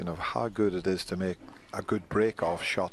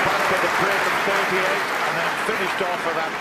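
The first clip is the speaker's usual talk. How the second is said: much higher, louder, thrilled; excited commentary; 11 semitones higher, 10 dB louder than usual. Real, not acted. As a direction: let the crowd hear your thrill. Commentator hails the big clearance.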